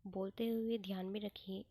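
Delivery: normal talking voice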